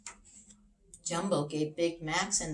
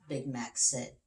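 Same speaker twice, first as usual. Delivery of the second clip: ordinary delivery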